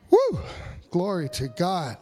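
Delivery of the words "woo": loud high exultant whoop; joyful whoop. Real, not acted. Pastor whooping after proclaiming the King of Kings, joyful loud whoop. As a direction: let out a triumphant woo.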